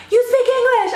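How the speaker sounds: high, loud desperate question; cling to him like a lifeline; squeaky hopeful panic; frantic high plea. Retold or imitated. Begging stranger for help at ATM.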